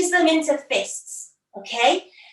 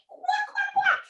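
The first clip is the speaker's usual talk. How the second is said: much higher playful quacking; duck quacks; 3 dB quieter than usual. Pretend. Teacher leading kids as flying ducks, high quacks. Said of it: "ducks and geese go quack".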